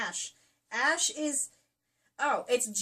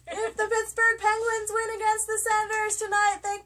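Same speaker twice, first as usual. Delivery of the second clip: higher, louder hype; excited announcement; 11 semitones higher, 9 dB louder than usual. Real, not acted. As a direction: hype it like a sportscaster.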